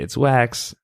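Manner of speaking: ordinary talk, nothing marked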